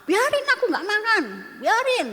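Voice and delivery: put-on high, whining child who refuses to eat; mock tantrum from a child who won't eat; whiny sulking child. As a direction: mimic a sulky kid refusing dinner, half-hearted whine. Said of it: "nangis teriak-teriak".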